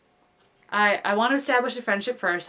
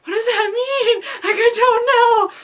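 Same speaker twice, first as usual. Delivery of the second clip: very high, loud, whimpering wail; panicked inner wail; 14 semitones higher, 9 dB louder than usual. Retold or imitated. Brain reacting to the brush-off, high loud panicked wail. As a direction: wail like your brain is melting down. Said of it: "my brain was like".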